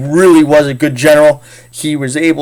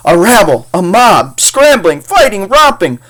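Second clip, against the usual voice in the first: sneering banker; 6 semitones higher, 3 dB louder than usual. Acted.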